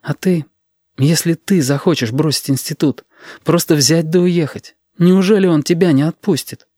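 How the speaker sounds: a hint of an anxious student's voice; Sashka, tentative questioning. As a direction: ask carefully in a high, pleading tone.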